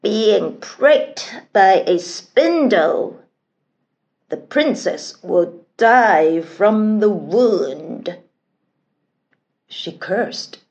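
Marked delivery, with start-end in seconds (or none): 0.0-8.2 loud, slow, drawn-out angry curse; furious curse. A narrator's reading. Forgotten fairy cursing the baby princess, furious and loud. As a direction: pronounce a curse slowly and forcefully. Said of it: "The forgotten fairy was furious".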